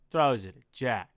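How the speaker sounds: ordinary talk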